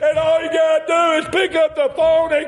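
high, drawn-out preacher's shout; full-force preaching. Real, not acted. Preacher urging the congregation to call for help, full-force preaching. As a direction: build into a high, chanting cry and hold it.

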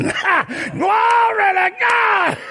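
high, long-drawn church shout; jubilant shout. Retold or imitated. Testifiers shouting praise before sitting down, jubilant shout. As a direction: cry it out in praise, stretching it slowly on a high held note. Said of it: "Before they sat down there shouting".

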